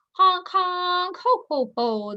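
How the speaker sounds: loud, high honking laugh; a noisy gander's mocking honk. A narrator's reading. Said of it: "the gander laughed".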